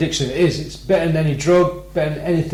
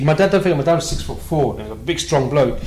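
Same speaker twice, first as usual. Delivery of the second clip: usual speaking voice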